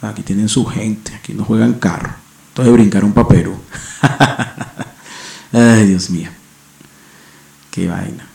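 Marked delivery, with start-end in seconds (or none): 4.0-4.8 short run of breathy chuckles; real amused cackle; laugh. Real, not acted. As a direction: laugh at your own remark. Laughing after his own remark.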